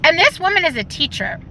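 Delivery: ordinary talk, nothing marked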